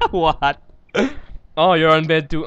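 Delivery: usual speaking voice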